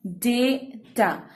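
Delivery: "डेटा" slowly split, long डे then टा; syllable break. Shown as a demonstration. Teacher models long A, "डेटा" broken slowly in two. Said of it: "because your syllable breaks".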